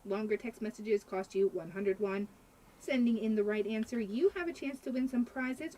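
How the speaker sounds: upbeat station promo read; radio announcer. Acted.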